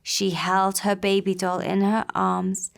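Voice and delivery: plain reading voice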